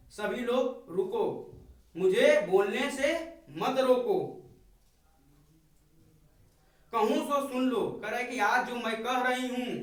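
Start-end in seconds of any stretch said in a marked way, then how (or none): 0.1-7.8 emphatic plea. Acted. Kaikeyi begging the assembly to let her speak, emphatic. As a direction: insist that nobody stop you. Paraphrases Kaikeyi pleading with the court.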